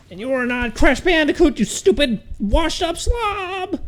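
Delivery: hammed-up screaming rage at Crash; furious shout. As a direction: scream the insults in an exaggerated put-on voice. Raging at the game character.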